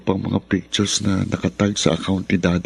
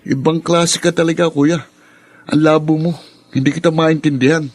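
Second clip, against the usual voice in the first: raised, accusing voice at his brother; louder, higher, confronting; angry confrontation; 6 semitones higher, 5 dB louder than usual. Acted.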